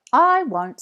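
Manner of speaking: high, loud, quick promise; eager promise. A narrator's reading. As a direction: call it back eagerly. Jack promising.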